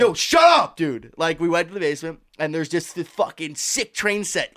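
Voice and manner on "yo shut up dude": loud, high shout of joy; excited shout. Retold or imitated. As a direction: yell with childish glee. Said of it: "We're like".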